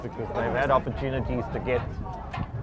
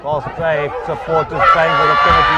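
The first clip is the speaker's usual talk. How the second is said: excited commentary; 6 semitones higher, 7 dB louder than usual. Real, not acted. Live commentary, crowd roaring.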